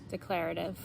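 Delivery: low, quiet, toneless robot voice; soft deadpan drone; robot monotone. Shown as a demonstration. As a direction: say it evenly like a bored robot. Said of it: "in almost like a robot monotone".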